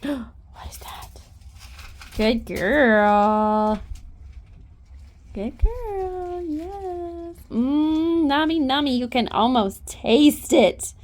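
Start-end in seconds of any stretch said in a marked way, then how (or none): 0.0-1.2 soft gasp, then a slow whisper; hushed surprise, slow. Real, not acted. Whispering in surprise to the horse. 5.3-9.0 high, sing-song praise. Real, not acted. Cooing higher praise to the mare. Praising horse, baby talk.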